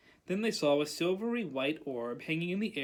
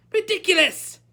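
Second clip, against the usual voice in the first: high, loud spell shout; pupil's high-pitched battle cry; 12 semitones higher, 10 dB louder than usual. A narrator's reading. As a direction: cry out the incantation at full force. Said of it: "cried Parvati".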